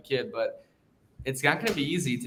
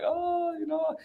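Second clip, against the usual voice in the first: high-pitched fake wail; mock bawling; 5 dB quieter, slower than usual. Boy driven home humiliated by the assistant coach, mock bawling. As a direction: imitate yourself wailing as a kid, half in jest. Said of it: "I'm bawling my fucking eyes out".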